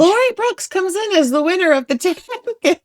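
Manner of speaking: high, loud mock announcer calling the winner; race announcer. Friend announcing her ten K win, loud mock announcer.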